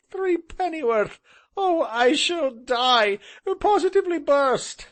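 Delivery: high, loud, slowed laughing wail; helpless laughter. Acted. Gentleman on the settle dying of laughter. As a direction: nearly burst with laughing.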